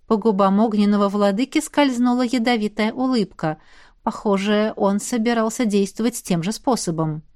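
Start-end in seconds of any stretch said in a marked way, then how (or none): none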